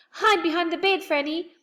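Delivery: high, loud urgent order; urgent high warning. A narrator's reading. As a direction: hurry her to hide.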